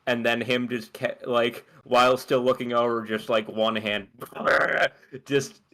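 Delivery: ordinary delivery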